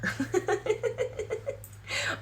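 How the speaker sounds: high unvoiced laugh; breathy laugh at her own joke. Real, not acted. Mocking tough love, she laughs high and breathy.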